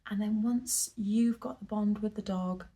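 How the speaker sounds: ordinary delivery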